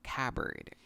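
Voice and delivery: "cupboard" as KUH-berd, silent p; correct pronunciation. Shown as a demonstration. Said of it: "this word is pronounced".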